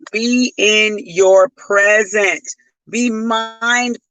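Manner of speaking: each word slowed and pressed; slow, emphatic repeat. Shown as a demonstration. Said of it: "repeats the phrase, each take different".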